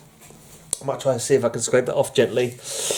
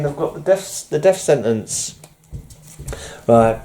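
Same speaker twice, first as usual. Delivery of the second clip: ordinary talk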